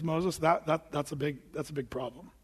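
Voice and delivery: low, soft, hesitant correction; hushed Lord pointing out trouble; quiet reproof. Acted. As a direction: break bad news under your voice. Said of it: "But the Lord says".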